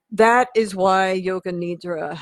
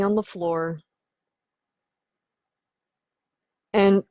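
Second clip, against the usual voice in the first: measured, unhurried meditation voice; guided meditation. Acted. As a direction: lead them into relaxation with long pauses. Leads guided relaxation.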